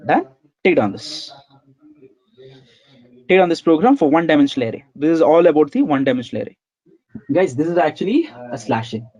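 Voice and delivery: ordinary talk, nothing marked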